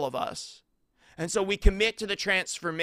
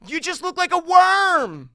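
high, loud, exasperated mock shout; hammed-up scornful cry; mocking retort; 12 semitones higher, 9 dB louder than usual. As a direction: throw it back as a blunt put-down. Acted onlookers' blunt retort.

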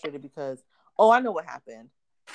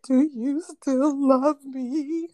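high, slow, sobbing plea; mock crying child; 9 semitones higher, 5 dB louder, much slower than usual. Mom imitates her crying oldest son, high slow mock sob. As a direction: wail it like a heartbroken kid. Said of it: "He's just crying".

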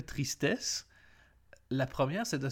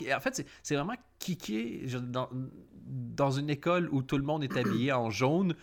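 ordinary talk

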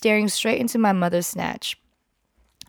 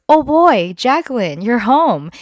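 mock cheery greeting; 2 semitones higher, 7 dB louder than usual. Mom's boyfriend greeting her at the door, loud and cheery. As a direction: burst out with fake delight.

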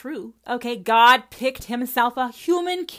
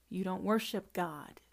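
usual speaking voice

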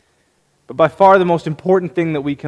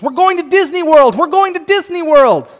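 much higher and louder, shouting with joy; little girl yelling her big news from the kitchen; excited screaming; 12 semitones higher, 5 dB louder than usual. Retold or imitated. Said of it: "runs into the kitchen screaming".

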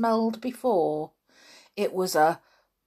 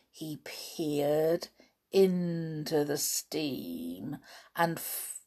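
whispering; 5 semitones lower, 8 dB quieter, slower than usual. A narrator's reading. Whispered narration.

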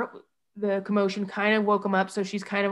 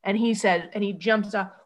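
ordinary talk

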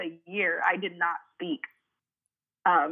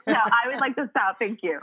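laughing; 5 semitones higher, 4 dB louder than usual. Real, not acted. Laughing mock demand.

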